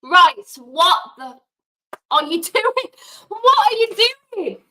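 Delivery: loud, high, furious shout at the player; exasperated fan shouting at the telly; angry outburst. Real, not acted. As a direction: yell at the screen in disbelief. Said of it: "Yes, I am getting angry".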